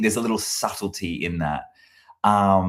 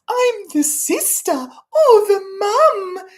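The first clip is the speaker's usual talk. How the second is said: shrill, high, loud falsetto mum; mocking caricature of a woman; 8 dB louder, slower than usual. Mocking a sketch mum, loud high falsetto. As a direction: ham up a mum voice in mockery. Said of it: "women get performed like this".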